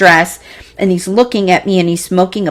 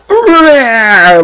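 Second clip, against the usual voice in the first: loud growled roar, a little higher; imitated roar; 4 semitones higher, 7 dB louder than usual. Retelling becoming a bear, loud growled roar. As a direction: roar like a bear mid-transformation.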